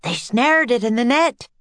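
mild excited shout. A narrator's reading. Tommy reporting the catch to the children, mild shout. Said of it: "shouted Tommy".

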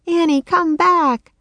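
high, slow plaintive call; croaked. A narrator's reading. Jack calling his sister back, a high croaking call. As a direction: call out high, drawing the words out. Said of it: "Jack croaked".

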